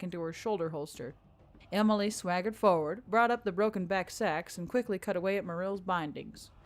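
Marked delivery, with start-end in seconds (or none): none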